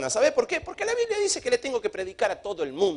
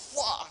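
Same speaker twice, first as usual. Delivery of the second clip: higher, quick burst of splash noise; imitated whoosh of water; 4 semitones higher, 7 dB quieter than usual. Water shakes him mid-prayer, imitated whoosh. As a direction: puff out a wave crashing.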